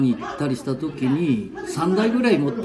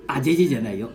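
usual speaking voice

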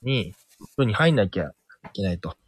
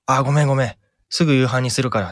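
hurried mother; 5 semitones higher, 6 dB louder, faster than usual. Retold or imitated. Real mother rushing in.

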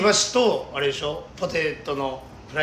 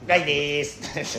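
Guy imitation; slower than usual. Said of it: "ガイさんのやり方を".

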